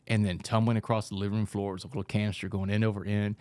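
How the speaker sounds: ordinary talk, nothing marked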